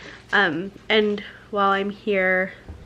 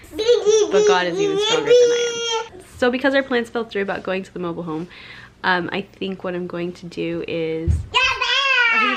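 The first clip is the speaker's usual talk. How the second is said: real crying; 6 semitones higher than usual. Real, not acted. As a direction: voice breaking into tears, pitch jumping high. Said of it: "I'm getting emotional".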